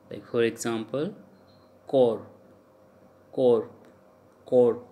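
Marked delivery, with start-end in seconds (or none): 1.9-4.8 "core," ending on R, no S; silent S. Shown as a demonstration. Teacher repeats a French word for learners, silent S in "core,". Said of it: "S is silent in the core".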